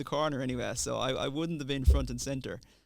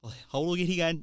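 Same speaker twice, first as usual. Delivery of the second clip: put-on Kerry accent; 5 semitones higher, 7 dB louder, faster than usual. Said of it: "That's the kind of way he would talk".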